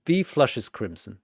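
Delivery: ordinary delivery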